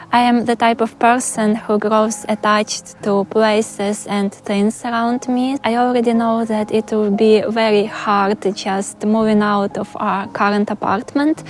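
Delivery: ordinary talk, nothing marked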